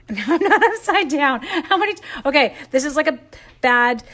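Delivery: loud, high laughing self-mockery; laughing frustration. Real, not acted. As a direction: laugh at your own clumsiness. Laughing at her failed bow.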